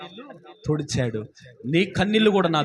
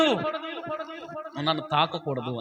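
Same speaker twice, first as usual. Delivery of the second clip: Jesus quietly telling Mary not to touch; soft warning; 7 dB quieter, slower than usual. Acted. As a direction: drop to a hushed, slow warning.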